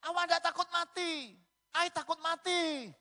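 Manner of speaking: high-pitched yell, very loud; hammed-up doctor scolding at the top of the voice; imitated doctor. Doctor warning patient he could die, loud high mimicry. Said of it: "Kok ngomongnya gini, Dok?".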